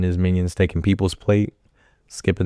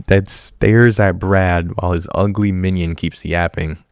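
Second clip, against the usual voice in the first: ordinary talk, nothing marked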